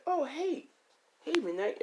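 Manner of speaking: high-pitched friendly hello; friendly greeting. Acted. Ray greeting Midnight, high friendly greeting.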